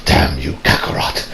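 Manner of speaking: low, whispered Vegeta grumble; bitter Vegeta. Retold or imitated. Vegeta cursing his rival, low and whispered. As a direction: spit it out under your breath in a low voice. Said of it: "He's like".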